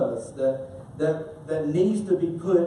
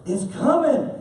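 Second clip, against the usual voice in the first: ordinary delivery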